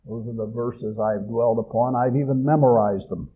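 normal talking voice

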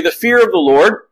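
ordinary talk, nothing marked

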